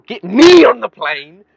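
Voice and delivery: high, loud impatient shout; mock yell. Fed-up traveller yelling at the airport desk, loud and impatient.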